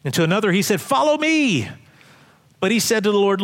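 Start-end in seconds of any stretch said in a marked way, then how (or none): 0.8-1.7 high, commanding call. Acted. Jesus calling another to follow, calling out. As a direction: call it out high, drawing out the end.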